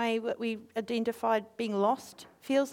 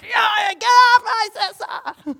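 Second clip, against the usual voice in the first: higher, loud, slow yell; mock battle shout; 9 semitones higher, 12 dB louder, slower than usual. Defending sister from bullies, loud yell. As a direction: roar it at the bullies.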